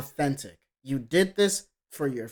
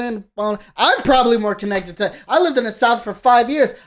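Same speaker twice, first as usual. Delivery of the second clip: loud, higher-pitched protest; indignant; 5 semitones higher, 5 dB louder than usual. Real, not acted. Critic claiming to be more southern than the singer, loudly indignant. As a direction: raise your voice in indignant disbelief.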